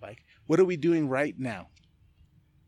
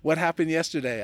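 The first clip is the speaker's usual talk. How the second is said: usual speaking voice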